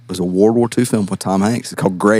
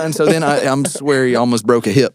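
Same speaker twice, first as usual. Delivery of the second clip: ordinary talk, nothing marked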